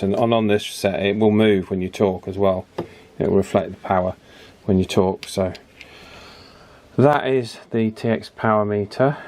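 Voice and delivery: usual speaking voice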